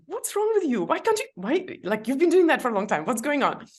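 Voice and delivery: high, loud, exasperated self-criticism; scolding yourself on the bike; harsh self-scolding. Retold or imitated. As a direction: snap at yourself for losing balance. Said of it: "I would start at myself, like".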